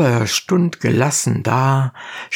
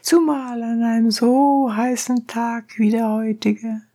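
very high, insistent donkey driver; high-pitched peasant appeal; donkey driver calling; 11 semitones higher than usual. A narrator's reading. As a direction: press your claim in a high voice. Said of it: "rief Anthrax".

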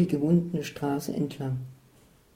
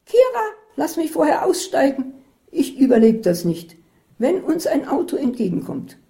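much higher, louder panicked plea; frightened cry; 8 semitones higher, 5 dB louder than usual. A narrator's reading. Isabel crying out to Katharina near the hotel, high and loud. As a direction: beg to be let out in terror. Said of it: "schrie Isabel".

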